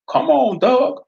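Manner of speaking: southern sheriff daring Fred to use his eye blaster; southern accent. Acted. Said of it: "the sheriff taunted".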